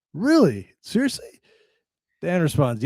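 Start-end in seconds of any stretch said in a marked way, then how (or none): 0.1-1.4 surprised. Retold or imitated. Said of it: "The guy responds".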